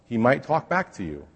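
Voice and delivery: ordinary talk, nothing marked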